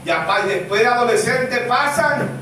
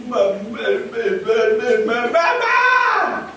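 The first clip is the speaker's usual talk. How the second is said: put-on baby's first words; slower than usual. Preacher voices a baby saying mamá for the first time. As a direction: coo it like a toddler's first word. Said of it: "llega el momento que empieza".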